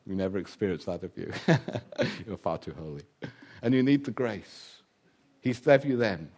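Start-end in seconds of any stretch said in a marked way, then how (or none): 0.1-3.0 teasing aside. Real, not acted.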